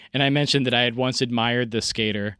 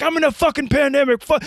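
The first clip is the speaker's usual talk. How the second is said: high, loud yelling at the phone; re-enacting screaming at a troll on the phone; retold screaming; 13 semitones higher, 5 dB louder than usual. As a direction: scream it like a maniac. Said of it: "I am screaming at my phone".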